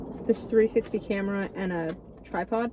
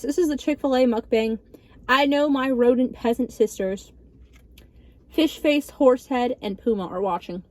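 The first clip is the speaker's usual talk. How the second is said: ordinary talk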